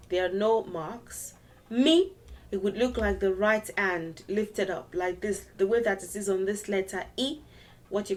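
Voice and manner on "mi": "Mi" high and rising. Shown as a demonstration. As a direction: lift "Mi" well above speaking pitch. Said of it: "what are the three tones".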